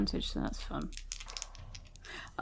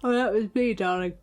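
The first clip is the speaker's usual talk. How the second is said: lazy drawl; 2 semitones higher than usual. Acted. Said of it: "half a yawn and half an exhale of smoke".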